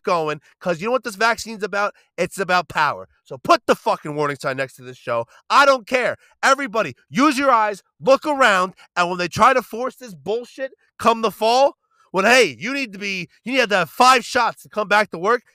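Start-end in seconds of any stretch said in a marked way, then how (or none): 5.5-15.4 loud, high-pitched, fired-up rant; heated rant. Real, not acted. Podcast host ranting at listeners about vaccine mandates, loud and heated. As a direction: push it out hot and loud, almost shouting. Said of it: "getting a little heated".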